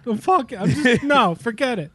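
mock exasperation. Acting out the self-conscious Pope.

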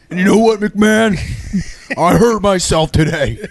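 [name] voice. Pretend. [name] bit, breaking into laughter.

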